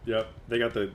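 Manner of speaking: usual speaking voice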